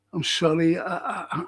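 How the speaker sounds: ordinary delivery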